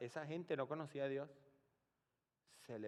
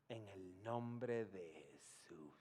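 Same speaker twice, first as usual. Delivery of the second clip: slow, softer, lower hushed prayer; 7 semitones lower, 6 dB quieter, slower than usual. Retold or imitated. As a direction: murmur it reverently at the bedside. Quiet prayer over the dying man. (oración lenta y en voz baja)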